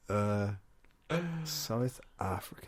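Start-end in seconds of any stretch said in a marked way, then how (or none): 1.4-2.7 slow, softer stab at a South African accent; attempted South African accent. Shown as a demonstration. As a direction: try out South African slowly and quietly. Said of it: "what does South African accent sound like again?".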